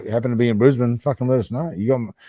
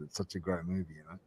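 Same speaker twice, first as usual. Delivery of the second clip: normal talking voice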